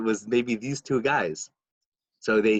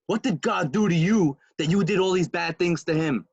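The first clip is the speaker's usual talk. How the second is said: raised-pitch scathing rebuke; indignant scolding; 7 semitones higher, 4 dB louder than usual. Retold or imitated. Rebbe rebuking a man asking for easier tasks, indignant. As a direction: tell him off in mock outrage.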